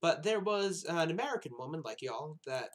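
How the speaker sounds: a nurse drawling the names with a hint of the American South; Southern nurse. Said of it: "uh, Southern again".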